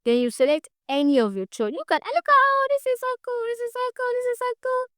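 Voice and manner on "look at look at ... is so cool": high-pitched excited squeal; excited gushing. Real, not acted. Gushing over the design options.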